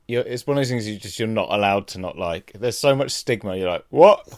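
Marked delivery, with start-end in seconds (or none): 3.9-4.3 mock shock. Mock disbelief at drinking stigma.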